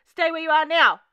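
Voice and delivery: loud, high shouted command; police yell. A narrator's reading. Cops to a trespasser caught at the window, yelling. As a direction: yell it out with full authority. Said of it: "the cops yell out".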